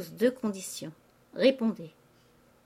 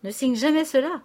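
high, loud warning; urgent cry; 6 semitones higher, 6 dB louder than usual. A narrator's reading. Father begging his daughter not to sign, crying out. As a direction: cry out to stop her at once. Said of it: "criait le père Goriot".

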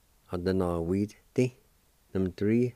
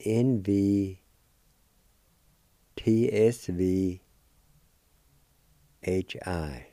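letters spelled out slowly; slow, careful letter spelling; much slower than usual. Shown as a demonstration. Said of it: "And it's spelled this".

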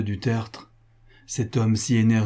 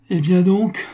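higher, louder burst of despair; desperate outcry; 9 semitones higher, 9 dB louder than usual. A narrator's reading. Cornered husband about to reveal all, desperate outcry. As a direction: cry out as if giving in. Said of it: "s'écria du Tertre, pâle, désespéré".

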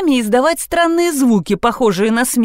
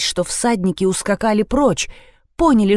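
usual speaking voice

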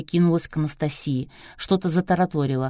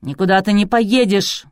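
loud, forceful refusal; barking; 4 semitones higher, 7 dB louder than usual. A narrator's reading. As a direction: bark a raised-pitch prohibition. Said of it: "рявкнул Клычко Жиляев". (рявкнув, громко и властно)

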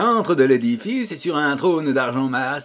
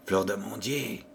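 fleur d'amandier murmured much softer and lower; 6 semitones lower, 9 dB quieter than usual. A narrator's reading. Pierrot sadly repeating his beloved's name, murmured. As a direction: murmur fleur d'amandier to yourself under your breath. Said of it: "murmura Pierrot à voix basse".

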